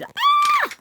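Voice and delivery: very high piercing scream; shrill high scream. Acted. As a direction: shriek as you're caught. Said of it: "She screamed".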